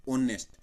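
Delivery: "ऑनेस्ट" with a silent h, punched; correct reading. Shown as a demonstration. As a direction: hit "ऑनेस्ट" from its opening vowel. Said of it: "एच इसके अंदर छुपा रहेगा". ("ऑनेस्ट" बिना ह के, स्वर से शुरू)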